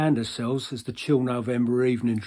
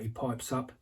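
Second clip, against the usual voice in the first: ordinary talk, nothing marked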